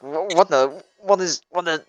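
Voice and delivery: acted rising panic, words tripping over themselves; panicking. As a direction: play a man starting to panic. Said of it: "kinda panicking".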